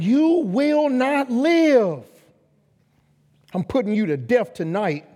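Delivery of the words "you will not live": slow, every word stressed; You will not live, drawn out and firm; slow defiant declaration. Retold or imitated. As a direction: spell it out with force. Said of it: "without saying".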